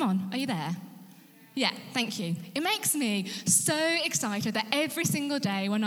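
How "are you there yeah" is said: normal talking voice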